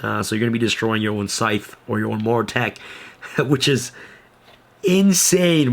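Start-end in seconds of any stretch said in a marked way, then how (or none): 4.8-5.7 sudden high, loud burst; excited exclaim. Real, not acted. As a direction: blurt it out, thrilled. Hyped about his own combo.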